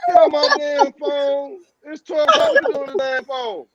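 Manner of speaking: high-pitched mama scolding on the phone; angry mama. Acted. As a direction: play a mother breaking into the call. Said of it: "till mama pick up that phone on the other end".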